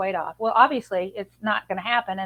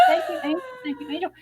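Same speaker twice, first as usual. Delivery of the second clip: gushing thanks. Retold or imitated. Grateful to the stranger, retold.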